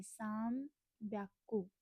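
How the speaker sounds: साम ब्याकू stretched slowly, both words drawn out; careful slow model of साम ब्याकू; slow pronunciation. Shown as a demonstration. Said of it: "यहाँ पर माँ बोलेंगे".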